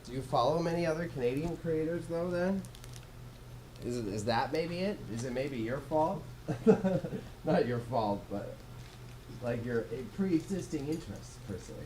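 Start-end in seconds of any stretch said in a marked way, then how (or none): 6.3-7.4 short, higher-pitched chuckle; quick breath of laughter; light laugh. Real, not acted. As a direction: laugh off the teasing question. Laughs at own teasing question.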